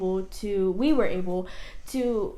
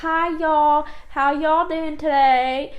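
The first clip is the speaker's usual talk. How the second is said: put-on higher, louder, slow Southern accent greeting; 7 semitones higher, 7 dB louder, slower than usual. Describing Kentucky and Tennessee locals to her wife, Southern accent. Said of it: "they'd be like".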